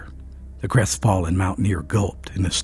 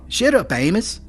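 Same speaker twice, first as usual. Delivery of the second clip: high, sharp command; sharp order; 13 semitones higher than usual. A narrator's reading. Storekeeper cuts off his raging friend, sharp. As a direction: cut him off sharply. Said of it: "ordered Phil sharply".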